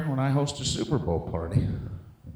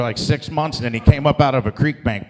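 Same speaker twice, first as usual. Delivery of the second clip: normal talking voice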